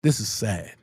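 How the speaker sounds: hint of a glum official; quoting the officer. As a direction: say it low and sorry, like the officer. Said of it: "And you know what they said?".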